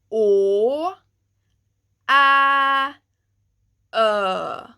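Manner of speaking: loud, clear "o, a, ö", each set apart; Swedish letters demo. Shown as a demonstration. Teacher introducing å, ä, ö sounds to learners. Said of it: "These ones are".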